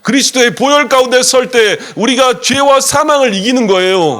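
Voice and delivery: high, loud fervent proclamation; preaching at full force. Acted. Pastor rousing the congregation to say amen, loud and fervent. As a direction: shout the victory to the church.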